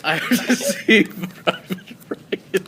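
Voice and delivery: slow, loud, breaking up with laughter; laughing. Real, not acted. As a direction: struggle through the line while laughing. Player laughing over the joke.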